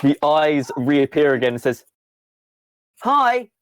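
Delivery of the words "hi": high-pitched, breezy computer greeting; chirpy computer character. Acted. Computer greets a player, chirpy and high. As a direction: pitch up as the all-seeing computer.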